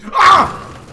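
piercingly high, loud scream; startled scream. Real, not acted. Player begging, then caught by a scare, terrified scream. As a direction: scream out in sudden fright.

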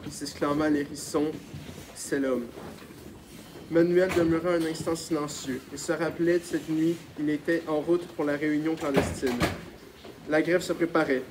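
ordinary delivery

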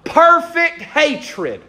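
preacher landing the answer slowly; drawn-out emphasis. Shown as a demonstration. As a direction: stretch out perfect hatred.

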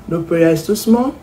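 ordinary talk, nothing marked

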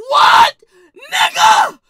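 screaming high and loud in disbelief; outraged shout. Retold or imitated. Shocked at grandma's clock trick, a loud high yell.

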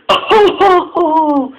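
high, loud mock giggle; giggling. A narrator's reading. Trucks laughing at gossip, high giggling. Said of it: "giggled the trucks in order".

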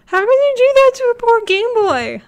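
high, loud, indignant squawk; hammed-up indignant cry; mock outrage. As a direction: protest like the Game Boy was hurt.

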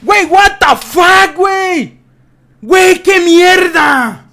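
slow, very loud, very high screaming; furious shout. Real, not acted. Streamer screaming at the player who killed him, slow and very loud. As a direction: scream in rage at dying.

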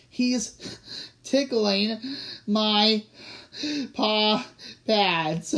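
helpless ticklish laughter between words; laughing while talking. A narrator's reading. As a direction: gasp out one word at a time through giggles. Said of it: "who was still laughing".

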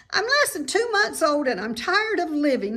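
high, loud piglet lament; whiny young pig.

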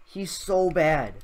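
ordinary talk, nothing marked

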